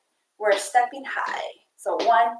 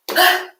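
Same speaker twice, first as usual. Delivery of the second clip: breathless gasp; 6 semitones higher, 5 dB louder than usual. Real, not acted. After running in place.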